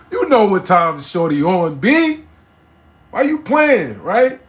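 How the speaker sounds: high and loud, why you playing; incredulous at the listeners, loud and high; incredulous rant. Real, not acted. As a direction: call them out, loud.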